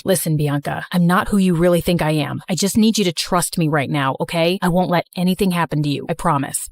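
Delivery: put-on deep, steady young man; calm her down in a low voice; low reassuring male voice. Slater reassures Bianca.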